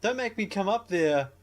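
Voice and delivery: high, loud threatening yell; mock threat. Ewan McGregor to the neighbour, loud mock threat. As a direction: bellow a threat at the ceiling. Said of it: "Ewan McGregor's like".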